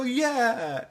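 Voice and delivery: high, drawn-out mock cheer; high-pitched imitation of a rallying cry; group cheer. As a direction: cheer along with the whole gang. Everybody go.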